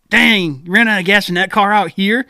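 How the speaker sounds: a hint of the amazed stranger; crackhead stranger.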